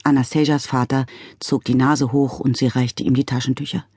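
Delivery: plain reading voice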